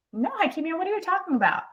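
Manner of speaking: puzzled coworker. Retold or imitated. Coworker denying it to her colleague, in a high puzzled voice.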